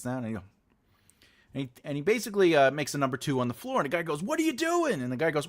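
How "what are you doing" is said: shocked agent. Retold or imitated.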